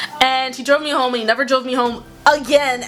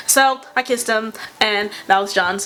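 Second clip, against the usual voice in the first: ordinary talk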